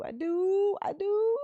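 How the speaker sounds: gushing affection. Pretend. Mama insisting she loves y'all, gushing affection. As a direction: squeal it upward, stretching the last word.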